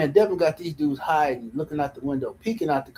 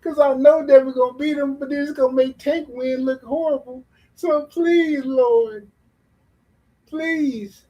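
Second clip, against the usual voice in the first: higher, slow, hammed-up begging to the Lord; mock pleading prayer; 7 semitones higher, 6 dB louder, slower than usual. Mocking Tank fans praying, hammed-up slow begging. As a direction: pray like a desperate fan, overplaying it. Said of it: "begging and pleading".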